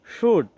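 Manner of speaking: "শুড" sounded as shood; correct pronunciation. Shown as a demonstration. Teacher drills how it sounds, correct "শুড" as shood. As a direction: say "শুড" with silent l and an oo vowel. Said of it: "অর্থাৎ sound হবে".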